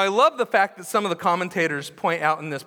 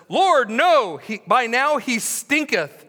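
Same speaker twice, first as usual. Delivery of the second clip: loud objection; 5 semitones higher, 7 dB louder, slower than usual. Acted. Martha objects in King James words.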